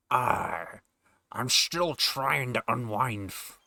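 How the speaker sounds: slow Scottish pirate drawl; slow, Connery-style old sailor; Sean Connery pirate. Acted. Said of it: "a fucking blending of A I voices".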